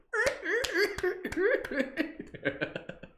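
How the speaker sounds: high, silly donkey and dolphin laughs; animal laughs. Pretend. Teacher doing weird animal laughs for class. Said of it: "a little bit of donkey".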